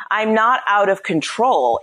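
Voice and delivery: ordinary talk, nothing marked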